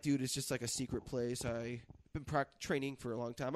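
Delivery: low, soft imitation of a cagey guy; secretive imitation. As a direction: play him confiding, low and quiet. Imitates karate guy being secretive.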